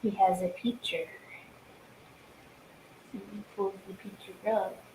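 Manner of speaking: soft unvoiced whisper; hushed aside to the room. Real, not acted. Whispered narration during stream.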